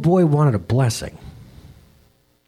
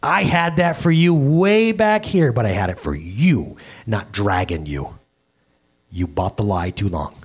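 gentle fatherly God; 5 semitones lower than usual. Retold or imitated. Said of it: "And he said".

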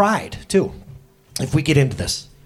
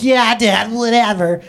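put-on dismissive kid; 5 semitones higher, 5 dB louder, slower than usual. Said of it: "Now they're probably like".

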